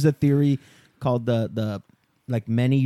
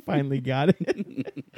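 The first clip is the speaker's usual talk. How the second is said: laughing; slower than usual. Real, not acted. Laughing fit mid-sentence.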